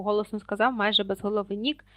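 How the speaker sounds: usual speaking voice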